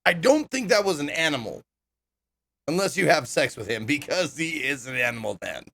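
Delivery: usual speaking voice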